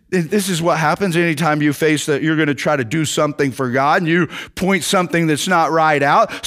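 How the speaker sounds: ordinary delivery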